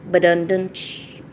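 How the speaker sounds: slow, low vocal drum sting; imitated rimshot. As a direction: mouth a punchline drum hit. Imitated drum sting after pun.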